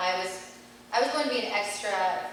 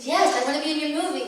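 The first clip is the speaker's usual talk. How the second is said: eager reply; 8 semitones higher, 6 dB louder, faster than usual. Retold or imitated. Eager teen answering the director.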